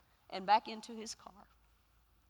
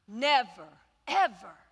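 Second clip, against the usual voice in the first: louder, punched Never ever; rallying emphasis; emphatic; 9 dB louder than usual. Acted. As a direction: hammer it home to the church. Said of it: "sermon climax".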